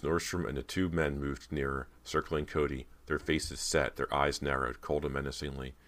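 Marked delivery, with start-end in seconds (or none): none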